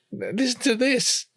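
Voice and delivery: put-on high, slow little kid voice; lightly voiced toddler; little child voice.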